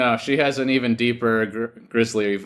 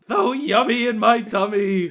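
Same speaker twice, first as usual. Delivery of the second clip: hammy king voice; 8 semitones higher than usual. Pretend. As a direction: overact a greedy jolly king. Playing the NPC king voice.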